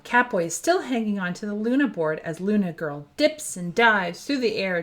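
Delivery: usual speaking voice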